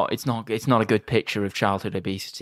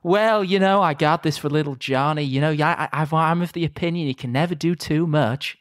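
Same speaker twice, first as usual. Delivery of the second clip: smarmy Ted; 7 semitones higher than usual. Retold or imitated. Mocking Ted's smarmy reindeer gift.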